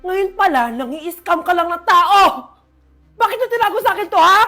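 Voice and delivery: loud, rising angry rebuke; furious scolding. Acted. Mother angrily confronting her children about their lies. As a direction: lash out at the lying children.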